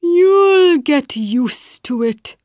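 very slow, sleepy caterpillar; drowsy, nearly nodding off. Acted. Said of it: "in a sleepy voice".

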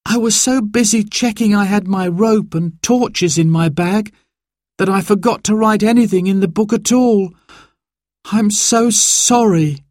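put-on high-pitched, remorseful child's voice; apologetic boy. A narrator's reading. Simon owns up to forgetting the book, very sorry. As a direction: confess it high, drawing out the apology.

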